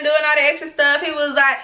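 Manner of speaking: normal talking voice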